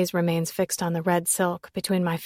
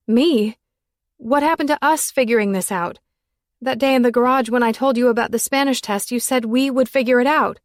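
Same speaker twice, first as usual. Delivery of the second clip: higher, louder accusing plea; upset raised voice; hurt outburst; 5 semitones higher, 6 dB louder than usual. A narrator's reading. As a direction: demand an answer from him. Accusing him, hurt and loud.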